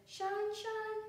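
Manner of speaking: high, sung-out call; sun shining. A narrator's reading. Sun peeping in the window, high sung call. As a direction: beam it out brightly. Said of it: "went the sun".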